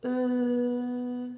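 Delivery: long, level "E" as French eu; small-mouth vowel. Shown as a demonstration. Teacher showing a child the mouth shape, small-mouth vowel. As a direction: hold "E" with lips small and forward. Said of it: "elle est petite devant".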